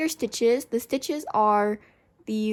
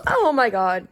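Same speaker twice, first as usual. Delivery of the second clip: louder, startled gasp of words; startled oh my God; 6 dB louder than usual. Real, not acted. Crafter startled when her camera falls, louder alarmed exclamation. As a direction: caught off guard as the camera drops.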